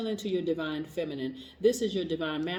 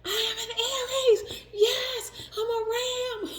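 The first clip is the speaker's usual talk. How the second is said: high, loud, laughing shout; loud and proud; 4 dB louder, slower than usual. Shown as a demonstration. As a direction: scream it out with joy like a proud ram. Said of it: "say it loud and proud. Scream it".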